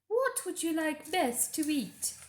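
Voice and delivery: scheming queen being gracious. A narrator's reading. The Queen's voice in narration.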